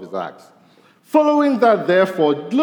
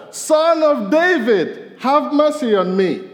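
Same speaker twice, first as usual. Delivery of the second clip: very high, slow, loud plea; crying out louder; 10 semitones higher, 4 dB louder, slower than usual. Retold or imitated. Preacher voices blind Bartimaeus crying louder to Jesus, slow pleading shout. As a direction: stretch it out and cry it even louder. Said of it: "he cried out all the louder".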